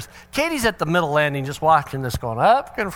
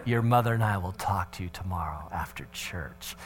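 dripping sarcasm; 5 semitones lower, 4 dB quieter than usual. Retold or imitated. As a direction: menace him with theatrical doom. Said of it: "said it with dripping sarcasm".

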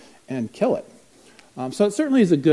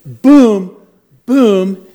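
loud, booming hit noises; two punchy booms, higher and louder; imitated impact; 6 semitones higher, 12 dB louder than usual. Kid ramming the speaker.

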